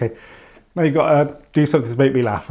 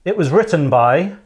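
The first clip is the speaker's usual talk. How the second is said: ordinary delivery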